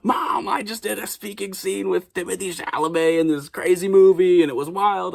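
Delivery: thrilled son phoning home; excited call to mom. Retold or imitated. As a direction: burst with news for mom. Calling mom after the shoot.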